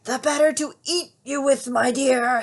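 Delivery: high, loud wolf snarl. Acted. Wolf snarling as he pounces at Little Red. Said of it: "snarled the wolf".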